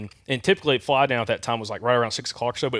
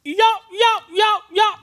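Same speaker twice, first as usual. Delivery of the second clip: loud, high, four-note box call; imitated turkey yelp; 5 dB louder, slower than usual. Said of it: "and I hear".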